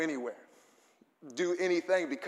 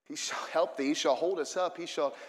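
ordinary talk, nothing marked

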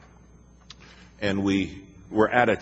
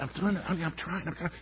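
high, soft strained wife voice; mock strained wife pleading; imitating wife; 9 semitones higher, 9 dB quieter, much faster than usual. Wife answers husband climbing the hill, high and soft. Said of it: "She said".

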